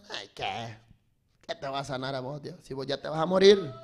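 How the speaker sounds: low, quiet put-down; dismissive naysayer. Retold or imitated. One of the crowd to a sick man, dismissive. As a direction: mutter it as a doubter.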